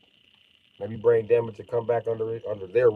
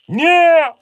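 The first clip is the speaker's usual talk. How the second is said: shrill, much higher triumphant yell; high shout; 9 dB louder than usual. Acted. Punchline shout.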